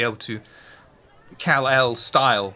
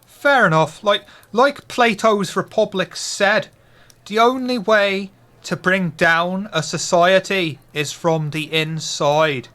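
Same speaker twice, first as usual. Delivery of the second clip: a lightly raised, louder barfly voice; Irish pub regular; 4 semitones higher, 5 dB louder than usual. Acted. GM continues as the friend.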